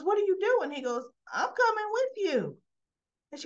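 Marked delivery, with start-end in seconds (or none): 0.0-0.7 high, loud, acted disbelief; raised-voice startled question; startled demand. Retold mother startled by Daniel's plans. 1.3-2.6 very high, acted declaration; high-pitched imitated protest; emphatic insistence. Retold Daniel insisting on joining her.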